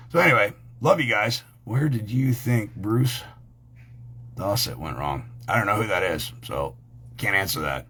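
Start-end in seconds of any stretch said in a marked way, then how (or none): none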